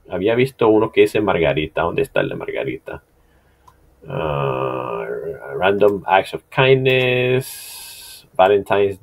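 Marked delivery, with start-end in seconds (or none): none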